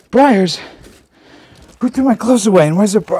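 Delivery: loud annoyed call; exasperated yell while searching. Real, not acted. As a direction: shout across the room for the gloves. Searching frantically, calling out.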